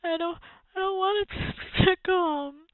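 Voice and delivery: high, loud bratty fake bawling; yelling through pretend sobs. A narrator's reading. Spoilt boy protests to his mother, fake sobbing yell. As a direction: wail with huge put-on sobs. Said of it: "yelled between huge pretend sobs".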